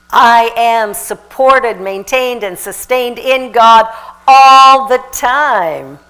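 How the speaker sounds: slow, loud, deliberate affirmation; a loud, slow mantra declared. Real, not acted. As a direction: declare each word. Speaking an affirmation.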